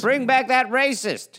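slow high yelling fan. Acted. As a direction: yell it like an angry fan.

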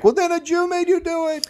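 high, hammed-up voice of a paranoid bigot; mock bigot sneer. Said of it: "they're like".

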